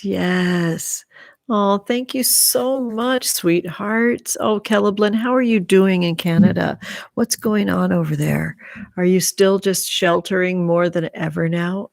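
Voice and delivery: ordinary talk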